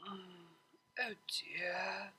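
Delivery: soft whispered mumble on waking; mumbled. A narrator's reading. Waking next to her friend, a sleepy whisper. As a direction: murmur it half asleep. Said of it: "she mumbled".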